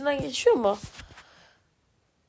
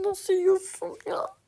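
incredulous; 6 semitones higher, slower than usual. Real, not acted. Stunned at teammate's play.

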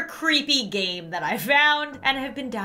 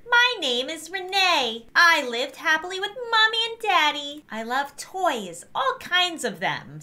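put-on higher, slow little girl voice; 6 semitones higher, 4 dB louder than usual. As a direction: read it like a sweet little girl. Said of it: "do a little girl voice".

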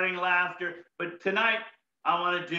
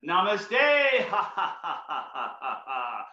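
namaste laughter, fading; slower than usual. Shown as a demonstration. Turning to a friend for namaste laughter. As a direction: laugh at a friend, letting it die away. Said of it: "Over to this side here".

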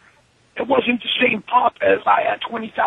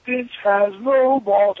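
slow taunt; 2 semitones lower, much slower than usual. Retold or imitated. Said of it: "I go look at him".

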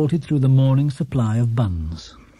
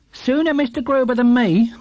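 housekeeper voice; 9 semitones higher than usual. Acted. Housekeeper remarks.

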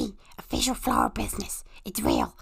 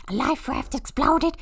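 normal talking voice

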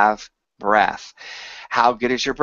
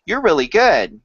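bright sincere praise; 5 semitones higher, 4 dB louder than usual. Shown as a demonstration. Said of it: "they all have different meaning".

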